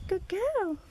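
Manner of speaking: high, loud squeaky praise; squeaky praising a dog. Real, not acted. Praising the dog after fetch.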